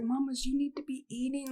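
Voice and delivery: quiet, "Mamas" with a light Mexican lilt; hint of a Mexican accent on "Mamas"; Mexican-tinged "Mamas". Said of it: "Why'd you say it like that? Was he Mexican?".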